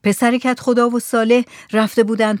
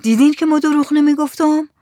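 higher, trembling narrated question; 6 semitones higher than usual. A narrator's reading. Man insisting to doubting villagers that he told no lie, trembling voice. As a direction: let a touch of fright lift the voice. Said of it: "با صدای لرزان گفت".

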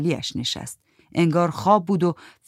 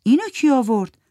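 Giti puzzled; 8 semitones higher, 5 dB louder than usual. Acted. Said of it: "گیتی گفت".

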